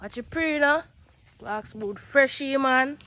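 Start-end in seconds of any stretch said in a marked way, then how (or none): none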